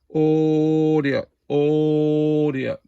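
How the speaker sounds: drawn-out audio check into the mic; radio test call. Real, not acted. Testing his CB transmit, drawn-out radio check call. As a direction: call it out slow like keying a CB.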